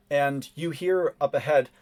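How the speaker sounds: normal talking voice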